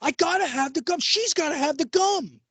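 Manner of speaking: put-on high, pleading kid begging for gum; whiny kid voice. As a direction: whine it like a desperate little kid. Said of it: "And I was like".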